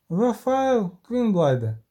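high, slow call without much volume; called-out cry. A narrator's reading. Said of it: "крикнула вона".

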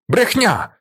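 high-pitched outburst of protest; indignant cry. A narrator's reading. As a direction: cry out in sudden outrage. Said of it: "кричить він".

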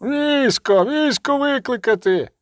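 high-pitched urgent call; shouted alarm. A narrator's reading. Second miner calls for the army, high shout. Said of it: "крикнув другий гірник".

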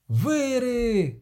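high, drawn-out cry without much volume; outraged cry. A narrator's reading. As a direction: cry out in alarmed disgust. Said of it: "крикнув Кобін".

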